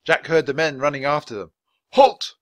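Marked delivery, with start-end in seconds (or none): 1.9-2.3 loud, high command to halt; samurai's cry. A narrator's reading. Samurai ordering fleeing children to stop, loud cry. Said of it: "cried a samurai".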